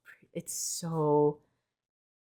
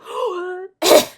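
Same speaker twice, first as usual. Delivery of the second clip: high gasp on opening; excited gasp; 14 semitones higher, 10 dB louder than usual. Real, not acted. Vlogger opens the new deck box, high gasp. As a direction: gasp sharply, pitched high.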